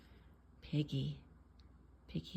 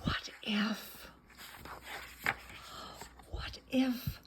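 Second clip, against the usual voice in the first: whisper; 8 dB quieter, slower than usual. Shown as a demonstration. Suspense reading.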